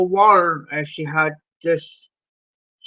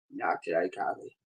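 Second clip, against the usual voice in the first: much softer, firm quiet no; quiet refusal; 10 semitones lower, 14 dB quieter than usual. Retold or imitated. Turning Kathy down a second time, dropping to a quiet firm no. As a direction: say it low and final, nearly under the breath.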